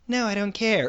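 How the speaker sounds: impersonating wife. Retold or imitated. Husband quotes his wife in an argument, lightly mimicking her dismissiveness. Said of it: "If I change my voice to impersonate her".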